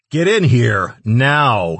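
loud, high commanding bellow. A narrator's reading. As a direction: roar the order across a cavern. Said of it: "he bellowed".

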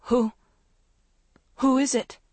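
high trembling call. Acted. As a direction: call out shakily into dark. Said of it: "called in a trembling voice".

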